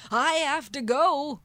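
loud, high-pitched sudden outburst; panicked blurt. Retold or imitated. She interrupts the Irish guy in panic, loud blurt. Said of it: "I just suddenly blurt out mid-sentence".

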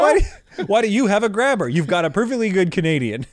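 high, offended protest coming down; mock indignation. Canadian complaining about the grabber, comically indignant. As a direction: act affronted at being replaced.

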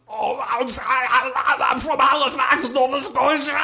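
high, loud action-hero scream; Schwarzenegger scream. Retold or imitated. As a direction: scream it like Arnold exploding. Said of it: "He goes".

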